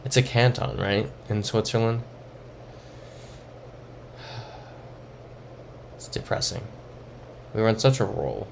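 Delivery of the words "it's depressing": deflated sigh. Real, not acted. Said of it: "I'm so deflated now".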